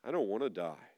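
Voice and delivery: quiet, low-pitched retold plea; hushed and low, lightly imitated child; soft child's reply. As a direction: say it softly, quoting a small boy. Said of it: "He says softly back to me".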